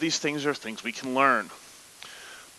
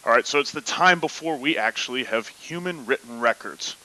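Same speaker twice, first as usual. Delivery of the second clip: normal talking voice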